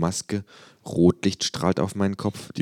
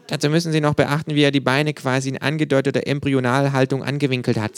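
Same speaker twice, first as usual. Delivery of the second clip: high, eager kangaroo voice; pseudo-intellectual kangaroo; 9 semitones higher than usual. Acted. Kangaroo lecturing visitor on the artwork, high eager voice.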